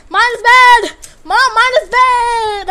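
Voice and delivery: high, loud crying whine, slow; whining to mom like a crybaby; mock whiny kid. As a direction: wail like a kid telling on someone to mom.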